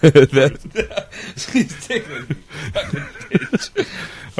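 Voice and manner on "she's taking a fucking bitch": squeaky high laugh through words; can barely talk for laughing. Real, not acted. Laughing hard at girlfriend.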